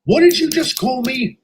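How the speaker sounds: angry demand. Acted. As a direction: demand an answer, offended. Insulted man confronts speaker.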